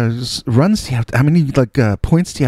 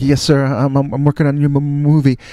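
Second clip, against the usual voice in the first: imitated tongue-tied politeness; nervous stammer; 4 semitones higher than usual. Nervous kid answering star about movie, stammering. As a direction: stumble over words, trying to answer respectfully. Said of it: "I'm so nervous".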